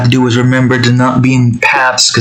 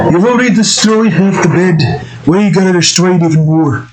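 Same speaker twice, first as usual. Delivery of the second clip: higher-pitched mock scolding; put-on scolding voice; 5 semitones higher than usual. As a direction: scold the pet in a put-on character voice.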